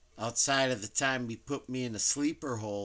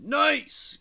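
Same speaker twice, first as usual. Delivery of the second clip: high, loud burst of delight; excited exclamation; 12 dB louder than usual. Retold or imitated. Retelling his reaction to a can of beer, excited exclamation. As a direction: exclaim it much higher and louder.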